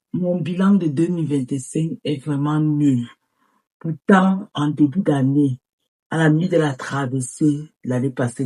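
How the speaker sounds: ordinary talk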